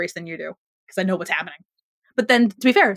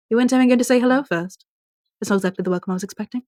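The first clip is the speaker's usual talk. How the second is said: put-on Rebecca Mader British accent; 3 semitones higher, faster than usual. Imitating a TV villain's British accent for her co-host. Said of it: "this is modeled after her in Once Upon a Time specifically".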